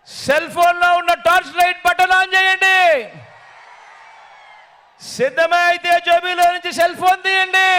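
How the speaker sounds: high, sustained calling to a crowd; rallying shout. Real, not acted. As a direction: urge the whole crowd to join in. Urges crowd to respond loudly.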